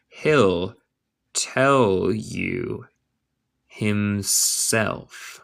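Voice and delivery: slow, with gaps between words; word-by-word model. Shown as a demonstration. Teacher modelling a line for English learners, word by word. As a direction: dictate it piece by piece.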